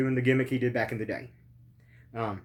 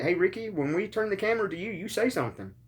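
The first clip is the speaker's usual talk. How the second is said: higher, quick mock director's instructions; imitating a director; 7 semitones higher, faster than usual. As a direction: rattle off the cue in a lightly put-on higher voice. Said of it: "That just like".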